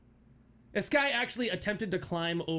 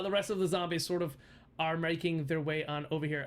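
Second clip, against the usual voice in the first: ordinary talk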